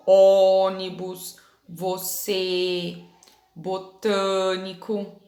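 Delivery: "Ônibus, você, botânico" slowly, holding each closed accented vowel. Shown as a demonstration. Teacher showing pupils the circumflex, closed vowels. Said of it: "som mais fechado".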